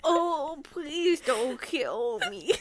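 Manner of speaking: mock frightened plea, slow and whimpering; mock terror. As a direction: beg for your life, hammed up. Mock plea to serial killer.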